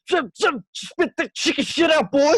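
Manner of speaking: high-pitched mock yelling; Kirk Lazarus. Podcaster imitating a man losing his mind, mock yell. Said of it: "yelling at Jay Baruchel's character".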